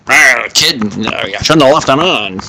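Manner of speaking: put-on old-school trader with cigar in mouth; movie trade-desk guy. Trade desk guy brushing off a novice, movie-broker style. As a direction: talk like a suspender-snapping movie broker. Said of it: "They talk like that".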